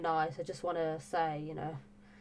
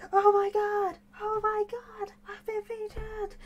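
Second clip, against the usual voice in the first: very high squealed retelling; overexcited; 5 dB louder, slower than usual. Retold or imitated. YouTuber reliving being featured by her idol, overexcited. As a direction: squeal it, starting loud, stretching the last words.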